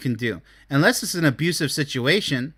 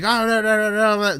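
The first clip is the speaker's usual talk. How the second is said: raised, high-pitched babble on one note; mock yelling; 6 semitones higher than usual. Showing the other extreme of the spectrum, mock yelling. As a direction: imitate someone annoyingly yelling everything. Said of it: "if you're yelling through life like".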